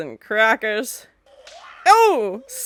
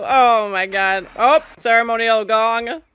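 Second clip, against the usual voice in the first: ordinary talk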